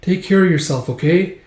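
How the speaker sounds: a hint of a small sidekick saying goodbye; Puck. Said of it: "And Puck's like".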